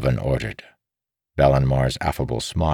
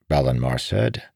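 ordinary delivery

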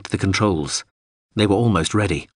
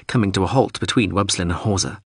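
usual speaking voice

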